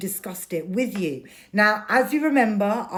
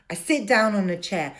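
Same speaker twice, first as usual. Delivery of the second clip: ordinary talk, nothing marked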